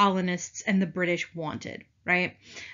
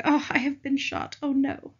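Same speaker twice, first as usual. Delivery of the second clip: higher, overdone theatrical death cry; hammed-up dying; 6 semitones higher than usual. Teacher mocks the painting's shot soldier, hammed-up higher wail. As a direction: melodrama of a shot officer, hammed up. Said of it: "he's so extra".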